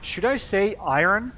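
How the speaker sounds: IRON as EYE-urn, loud and high-pitched; idiot's pronunciation. Shown as a demonstration. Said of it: "like an idiot".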